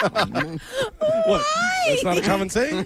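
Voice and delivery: high, squeaky words through real laughing; helpless laughter. Real, not acted. Joker defending his silly saying, helplessly laughing. Cracking up at his own joke.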